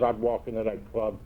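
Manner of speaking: normal talking voice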